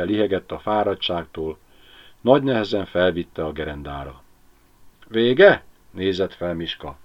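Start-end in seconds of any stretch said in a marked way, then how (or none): 5.1-5.6 asked higher and louder, eager to know; dog looking up asking if it's over; eager question. A narrator's reading. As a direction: pop the question up high. Miska asks if it's over.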